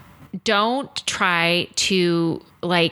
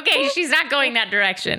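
higher amused inner voice through a laugh; 7 semitones higher than usual. Retold or imitated. Amused inner thought about the guest's direction, laughing. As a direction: chuckle it out as a private thought. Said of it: "I'm like".

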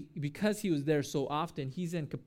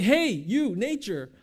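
loud, high, commanding call; rebuke; 5 semitones higher, 8 dB louder, slower than usual. Acted. Jesus talking against nature in the storm, loud rebuke. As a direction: shout it out like scolding someone across the way. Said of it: "He rebukes".

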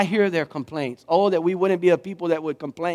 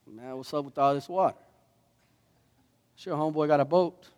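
put-on softer, laid-back take on a Luling accent; 5 dB quieter than usual. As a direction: try out a playful Luling homeboy accent. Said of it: "How they say it in Luling".

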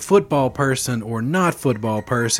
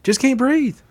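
whiny mask complainer, much higher; mock whine; 14 semitones higher, slower than usual.

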